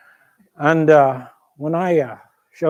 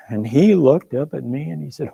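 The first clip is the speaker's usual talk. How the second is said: ordinary delivery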